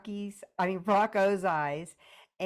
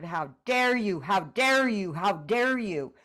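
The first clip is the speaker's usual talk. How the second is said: louder, punched repetition of a demand; repeated accusation; 7 dB louder, slower than usual. Shown as a demonstration. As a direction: show her how to hammer it home. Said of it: "by really more a repetition of".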